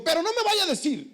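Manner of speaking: hammed-up tearful plea; tearful lady. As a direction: imitate a crying woman begging. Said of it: "empezó a llorar".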